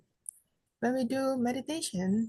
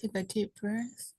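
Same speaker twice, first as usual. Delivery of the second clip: soft, unhurried meditation guidance with long pauses; guided meditation; 4 dB quieter than usual. Real, not acted. Teacher guides seated group to relax the body, soft meditation voice. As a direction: settle them gently, leaving long silences between phrases.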